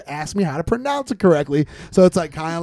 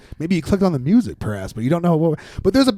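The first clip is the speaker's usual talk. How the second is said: normal talking voice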